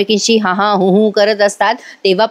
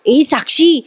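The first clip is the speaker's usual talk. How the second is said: higher call across the room; 6 semitones higher, slower than usual. Retold or imitated. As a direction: call her name to get attention. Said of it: "आवाज देत असते आधी साक्षीला".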